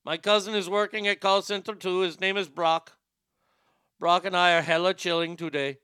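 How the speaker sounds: put-on comic Indian call-center accent; imitated Indian agent trying to sound Californian. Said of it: "when I'm in that accent".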